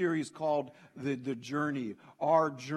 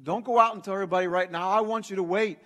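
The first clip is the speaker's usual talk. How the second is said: louder, higher, quick insistence; emphatic instruction; 4 semitones higher, 6 dB louder, faster than usual. Acted. Jesus telling his witnesses not to go yet, insistent. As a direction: press the order home firmly and fast. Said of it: "And here's what Jesus says".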